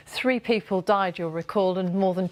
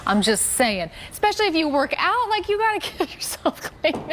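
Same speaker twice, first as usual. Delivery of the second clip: anchor stifling giggles; 8 semitones higher than usual. Real, not acted. Anchor giggling through remark.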